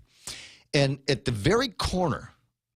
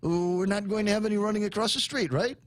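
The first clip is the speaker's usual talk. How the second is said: higher mock officer warning; pretend cop; 6 semitones higher than usual. Host imagining an officer warning schoolkids, mock stern. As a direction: play a cop at the window warning kids. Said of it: "like this".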